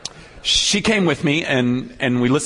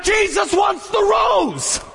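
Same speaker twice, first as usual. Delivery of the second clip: much higher, slow impassioned cry; held-back scream; much slower than usual. Retold or imitated. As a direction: cry it out like you can barely hold it in. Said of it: "all I could do not to scream out".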